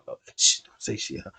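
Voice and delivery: breathy whisper, shushing; whispered warning to keep quiet; whispered hush. Retold or imitated. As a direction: hiss it under your breath. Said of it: "she looking at him like".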